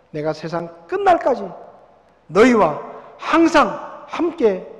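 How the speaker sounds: loud, ringing preacher voice; preaching at full force. Acted. Pastor to his congregation, loud fervent preaching. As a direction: proclaim it to the whole hall.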